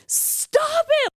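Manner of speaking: very high, drawn-out motherly scold; high mock-motherly protest; scolding little mother. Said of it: "I was being, like, their little mother".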